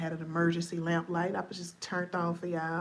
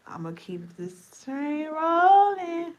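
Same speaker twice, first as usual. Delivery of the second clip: hyped; 8 semitones higher, 8 dB louder, slower than usual. Real, not acted. Hyped slow high declaration.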